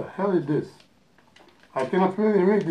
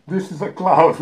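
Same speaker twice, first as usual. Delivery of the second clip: laughing; 7 dB louder than usual. Real, not acted. Laughing at candy.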